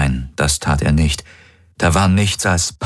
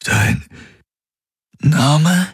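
pained; 11 semitones higher than usual. Acted. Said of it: "wiederholte er gequält".

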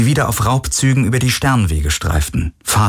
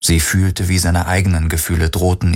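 plain reading voice

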